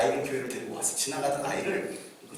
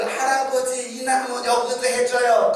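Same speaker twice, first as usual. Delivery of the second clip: put-on child pleading; 7 semitones higher, 9 dB louder, slower than usual.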